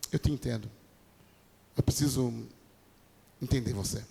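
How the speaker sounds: gentle understanding. Acted. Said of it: "você não fala assim pra ele assim".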